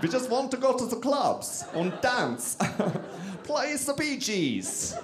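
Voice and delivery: high, giddy mock-German party voice; German accent. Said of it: "that's my German accent".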